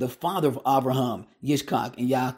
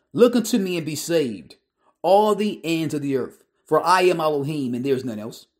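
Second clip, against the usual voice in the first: proclaiming scripture; 2 semitones higher, 3 dB louder than usual. Acted.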